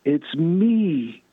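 mock scream, long held vowels; imitated joyful scream. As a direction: retell her screaming, stretched out slow and high. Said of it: "then she starts screaming".

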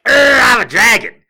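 high, loud mock monster growl; hammed-up dragon roar. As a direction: play a cheap TV dragon. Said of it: "he's all like".

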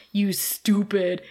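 slow whispered dumb insult; Buffy hitting Chet, slow dopey whisper. Retold or imitated. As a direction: breathe it out like a dim caveman. Beer-dumbed Buffy insults Chet.

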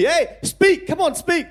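high, loud jeering taunt; mocking villagers. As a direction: egg him on like a teasing crowd. Villagers mocking his Aussie twang.

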